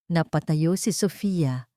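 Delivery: ordinary delivery, nothing marked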